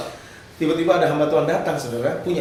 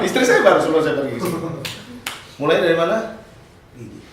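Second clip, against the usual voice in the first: ordinary talk, nothing marked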